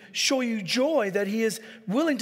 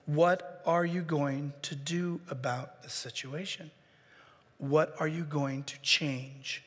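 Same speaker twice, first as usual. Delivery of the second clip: slow, soft, deliberate asking; a slow quiet counselor's question; calm firm question; 6 semitones lower, 6 dB quieter, slower than usual. Shown as a demonstration. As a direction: ask it gently but firmly. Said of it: "And then ask them".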